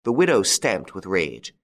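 ordinary delivery, nothing marked

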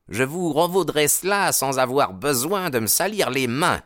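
haughty promise of revenge; threatening rival. A narrator's reading. Baldwin refusing to dirty his hands, threatening.

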